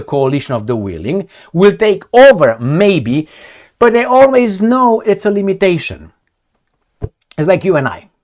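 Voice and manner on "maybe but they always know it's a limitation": ordinary talk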